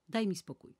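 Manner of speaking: loud, irritated outburst; angry shout. A narrator's reading. Nana snapping at her nagging maid, loud angry shout. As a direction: snap at her to be left alone. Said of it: "Nana, rozgniewana i zdenerwowana, krzyknęła".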